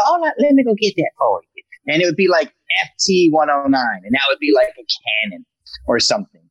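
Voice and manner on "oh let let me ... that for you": high, sweet obliging secretary; imitating the receptionist. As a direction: play the helpful office lady, pitched up. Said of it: "she would go".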